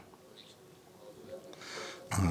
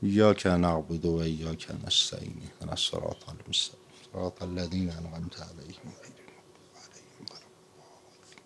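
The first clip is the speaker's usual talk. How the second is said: Quran recitation fading to whisper; 4 semitones lower, 9 dB quieter than usual. Acted. Preacher reciting Fatiha for the sick, devout recitation fading to whisper. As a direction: recite devoutly, letting the voice fall away.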